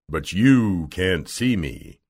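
low, slow mysterious wizard; drawn-out, imposing low voice; Oz's voice. Acted. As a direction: intone it slowly from hiding. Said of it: "said the voice".

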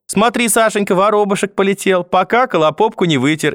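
very high, sing-song cooing cheer; merry grandmother. A narrator's reading. Grandmother trying to make her grandson laugh, merry chirping. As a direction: chirp it gleefully, high then easing down. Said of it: "весело заговорила".

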